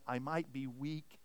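soft, slow, low and gentle; nice and gentle. Acted. Preacher quotes the nicely worded worship lyric, soft and gentle. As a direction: quote it sweetly, slow and hushed. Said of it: "it sounds really nice".

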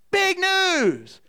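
high, loud, falling at the end; imitated kids' shout. Grandkids announcing it all afternoon, high imitated shout. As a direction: call it out like an excited child. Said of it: "screaming out".

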